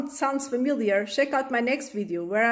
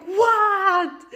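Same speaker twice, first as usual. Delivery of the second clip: sharp, higher, loud "What?"; exasperated shout; 10 semitones higher, 7 dB louder than usual. Acted. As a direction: snap back at the nagging kid. Mom snaps at calling child.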